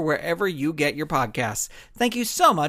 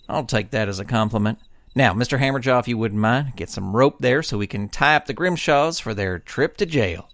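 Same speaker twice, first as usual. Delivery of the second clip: low, steady lawman with the upper hand; confident deputy; 8 semitones lower than usual. Acted. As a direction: savor the reveal calmly. Deputy gives orders.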